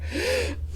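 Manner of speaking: high sharp frightened gasp; mock fearful gasp. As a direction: gasp as the steps come closer. Gasp as footsteps near.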